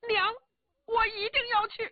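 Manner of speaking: put-on high boyish voice, firm; a young boy refusing to be stopped; determined boy. Son insisting to his mother, high and firm.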